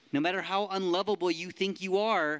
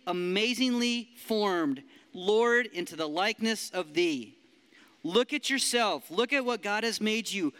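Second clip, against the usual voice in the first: lifted, expressive poem recital; poem reading; 4 semitones higher than usual. Acted. Preacher reading a poem to the youth, lifted and joyful. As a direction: read it like a joyful praise poem.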